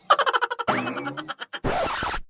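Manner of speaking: a sudden high, giddy laugh; high-pitched laugh. Acted. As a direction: laugh up high in a rising burst. Amma laughs alone. (ऊँची खिलखिलाती हँसी)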